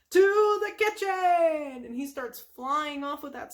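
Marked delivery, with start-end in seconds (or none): 0.1-1.8 high, drawn-out heroic shout; SpongeBob. Retold or imitated. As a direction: proclaim it like a battle cry.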